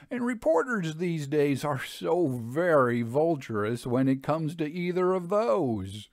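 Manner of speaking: oily blackmailer pressing his price; lightly voiced Lavelle. A narrator's reading.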